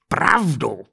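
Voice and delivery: restrained narrator's roar; bellowed. Acted. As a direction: throw the word out in outrage. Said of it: "Zařval".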